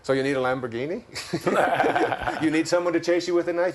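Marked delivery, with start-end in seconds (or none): 1.1-2.4 a short amused laugh; bright burst of laughter. Real, not acted. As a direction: laugh at the Lamborghini idea. Laughing at the Lamborghini joke.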